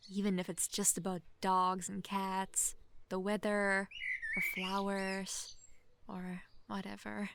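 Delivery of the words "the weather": normal talking voice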